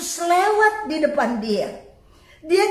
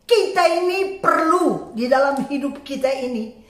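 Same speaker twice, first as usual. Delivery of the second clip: normal talking voice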